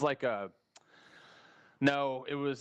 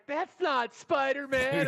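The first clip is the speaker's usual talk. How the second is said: put-on much higher, slow kid voice; kids' voices; 4 dB louder, much slower than usual. Little kids at the theater crying out, high kid voice. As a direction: drawl it out like a disappointed child. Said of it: "all the kids are like".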